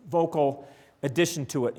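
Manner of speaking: normal talking voice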